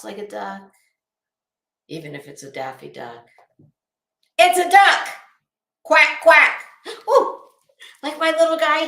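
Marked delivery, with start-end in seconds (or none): 4.4-6.6 loud quack impression. Calling Betty a duck, loud playful quacking. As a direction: belt it out, then quack loudly.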